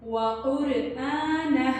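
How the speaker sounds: कुरआना with a full heavy क़, slow; heavy qaf. Shown as a demonstration. Teacher repeats the correct heavy qaf. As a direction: thicken the क़ of कुरआना. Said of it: "पुर पढ़ेंगे".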